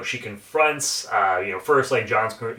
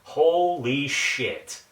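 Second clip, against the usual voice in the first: slow, higher, stretched-out amazement; retold awe at a jump scare; awed shock; 4 semitones higher, much slower than usual. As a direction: let it out slowly in awe. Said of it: "And I was just like".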